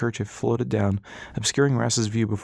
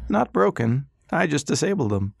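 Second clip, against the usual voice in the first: put-on old doctor; 5 semitones higher than usual. Old doctor demanding the ship's location, high and sly. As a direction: sweetly press him for the ship. He grinned.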